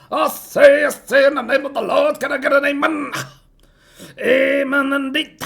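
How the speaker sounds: loud, high-pitched revival preacher; fired-up preacher. Shown as a demonstration. Preaching passionately to a congregation, fired-up preacher. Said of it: "from those preachers".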